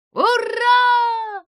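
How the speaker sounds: loud, high cheer. Acted. The crowd of geniuses cheers, loud and high. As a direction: throw it up as a joyful roar.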